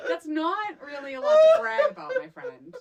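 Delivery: laughing. Real, not acted. Teasing friend through laughter.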